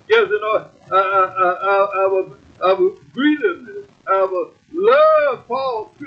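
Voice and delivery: ordinary delivery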